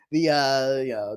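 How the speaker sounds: ordinary delivery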